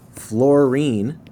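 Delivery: fluorine spelled out slowly as two syllables, fluor and ine; slow syllable demo. Shown as a demonstration. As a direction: stretch fluorine so the two syllables are heard. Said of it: "you hear the two syllables right there".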